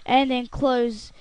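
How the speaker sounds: normal talking voice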